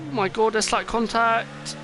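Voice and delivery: sudden high gasp of alarm; surprised exclamation. Real, not acted. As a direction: blurt it out, startled. Commentator sees cars touch.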